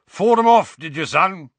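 loud, high, hearty boom. A narrator's reading. Father steering talk onto fighting, loud. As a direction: boom it out at your son. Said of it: "said Uncle Vernon loudly".

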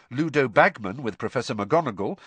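ordinary delivery, nothing marked

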